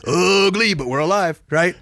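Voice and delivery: loud imitation of Brett; just like Brett. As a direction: shout it like a pilot glad to be alive. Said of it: "just like that".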